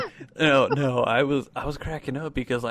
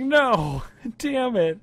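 anguished cry; 10 semitones higher, 4 dB louder, much slower than usual. Retold or imitated. Dropping tater tots, retold.

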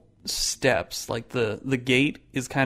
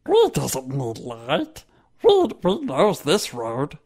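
hunchback assistant; slower than usual. Acted. GM voicing the guide Ghulam.

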